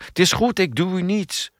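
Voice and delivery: high-pitched placating voice; surrendering. A narrator's reading. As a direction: pitch it high to show you mean no harm. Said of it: "Ze hield capitulerend haar handen omhoog".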